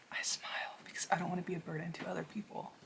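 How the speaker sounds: fast, voiceless whisper; hushed whisper. Real, not acted. Repeating the singer's words to viewers, in a whisper. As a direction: let it slip out like a confession. Quoting singer's confession, moved.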